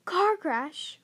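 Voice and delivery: loud, high indignant roar. A narrator's reading. Hagrid roaring at the Dursleys over the lie, loud and furious. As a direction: bellow it back in outrage. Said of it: "roared Hagrid".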